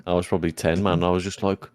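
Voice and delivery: ordinary delivery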